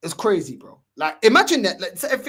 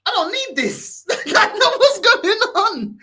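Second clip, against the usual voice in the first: high, slow, laughing through the words; 12 semitones higher, 6 dB louder, slower than usual. Retold or imitated. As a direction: laugh it out while telling it. Laughing while retelling his reaction.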